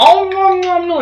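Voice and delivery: very high squeaky nom nom nom; cartoonish chomping noises; mock eating noise. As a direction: mimic a gross eater's munching. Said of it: "And I was like".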